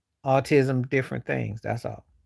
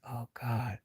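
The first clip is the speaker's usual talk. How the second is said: hushed, much softer inner thought; quiet dismay; 11 dB quieter than usual. Retold or imitated. Guest thinking to himself at the dog's mess, quietly dismayed. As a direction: say it under the breath, dismayed.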